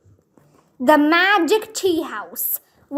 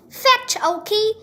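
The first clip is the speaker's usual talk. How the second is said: lightly raised call to the dog; mild shout; 7 semitones higher than usual. A narrator's reading. Annie tossing the ball for her puppy, mild call. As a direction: call out, bright and lifted. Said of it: "Annie shouted".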